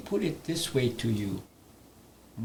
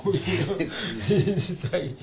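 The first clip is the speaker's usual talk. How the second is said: laughing; 4 semitones higher, much slower than usual. Real, not acted. Laughing at his own story.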